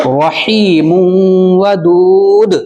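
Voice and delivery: ودود ended with a stop on the دال; tajweed recitation. Shown as a demonstration. Teacher shows stopping at the end, recited. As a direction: recite رحیم ودود and stop on the last letter. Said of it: "رک جائیں گے".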